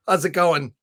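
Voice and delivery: ordinary talk, nothing marked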